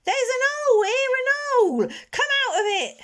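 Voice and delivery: put-on very high, loud, excited child; piping up excitedly. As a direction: shout out the discovery. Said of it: "piped up excitedly".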